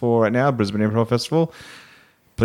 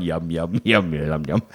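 low yum noise; 7 semitones lower, slower than usual. Retold or imitated.

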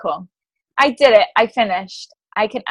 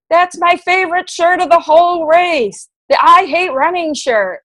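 booming announcer call, higher and louder; 8 semitones higher, 6 dB louder than usual. Retold or imitated. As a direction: boom it out like a race announcer over the speaker. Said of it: "He says over the speaker".

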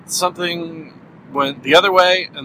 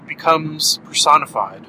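usual speaking voice

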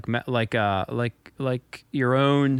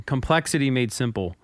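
normal talking voice

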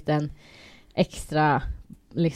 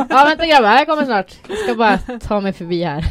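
high, loud plea to wait; comic straggler's shout; calling after others; 6 semitones higher, 9 dB louder than usual. Retold or imitated. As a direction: call out ahead, easing off at the end. Acts calling after faster, taller lads.

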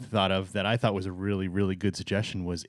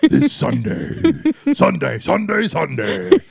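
loud echoing announcer hype; monster truck ad; 8 semitones higher, 6 dB louder than usual. Pretend. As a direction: bellow it like an arena ad. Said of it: "You know the monster truck commercials?".

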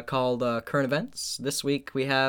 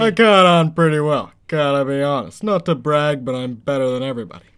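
loud, puffed-up boasting; mock bragging; 8 dB louder than usual. Host boasting about his roller skating, loudly cocky. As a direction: boom it out, full of yourself.

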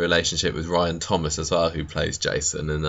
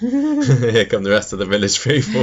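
announcer voice; 4 semitones higher, 5 dB louder than usual. Retold or imitated. Mock-announcing the village people arriving.

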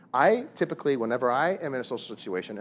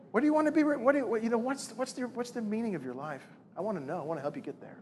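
mock tender questioning; 9 semitones higher than usual. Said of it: "You guys are laughing".

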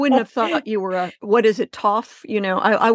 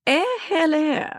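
high posh English "Eh, hello"; imitating a posh British lady's greeting; upper crust British; 9 semitones higher than usual. Teaching a friend her upper crust hello, mock posh British. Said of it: "Chris taught me".

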